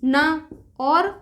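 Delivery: "ना" stretched out very slowly to break it apart; "ना" pulled apart at a slow pace; splitting the syllable. Shown as a demonstration. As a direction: draw "ना" out slowly to show its parts. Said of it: "जैसे ना को हम तोड़ दें".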